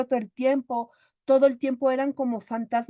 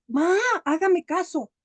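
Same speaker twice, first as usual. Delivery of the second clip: high, drawn-out plea for attention; louder insistence; 7 semitones higher, 4 dB louder, slower than usual. Acted. Son demanding his mother's attention, loudly insistent. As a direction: wail for mom to listen, acted. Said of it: "cuando ya le habla más fuerte".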